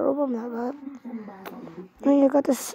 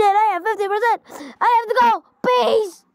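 excited rush; 8 semitones higher than usual. Real, not acted. Gamer rushes off, excited.

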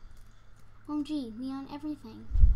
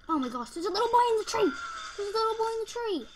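high, fast excited squeal; 8 semitones higher, 7 dB louder, faster than usual. Real, not acted. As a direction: gush about it rapidly. Thrilled at the game event.

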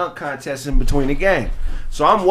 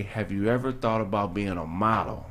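smooth pitch; 9 semitones lower, 9 dB quieter than usual. Retold or imitated. Stranger's pitch, voiced low.

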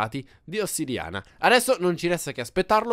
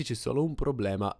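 ordinary talk, nothing marked

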